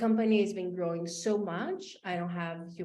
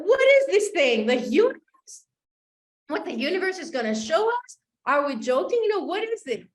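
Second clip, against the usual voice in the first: loud, higher, exasperated retort; mocking the coach's universe talk, much louder; annoyed outburst; 8 semitones higher, 8 dB louder than usual. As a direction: scoff at the idea, fed up. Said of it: "And I got so annoyed at her".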